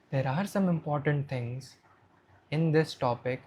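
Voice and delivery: soft, low, homely delivery. Shown as a demonstration. Coach shows a presenter lowering the voice, soft and low. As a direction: bring the voice down, soft and natural. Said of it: "at times you need to lower your voice".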